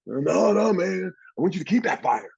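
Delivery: high, loud pep talk; fired-up encouragement. Retold or imitated. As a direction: fire up a teammate. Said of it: "shake hands and say".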